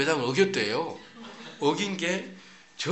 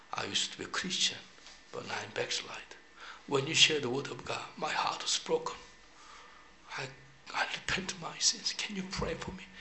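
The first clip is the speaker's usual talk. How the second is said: tearful plea; 6 dB quieter, much slower than usual. Retold or imitated. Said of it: "울다 온 것 같아".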